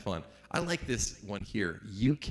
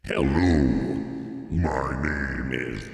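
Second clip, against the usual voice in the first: spooky modulated announcer; ring modulator voice; 7 semitones lower, 3 dB louder than usual. Shown as a demonstration. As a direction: lower metallic ring mod voice. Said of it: "ring modulator".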